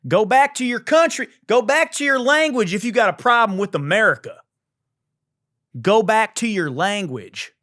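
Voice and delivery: high, loud hammed-up patriot; mock angry rant.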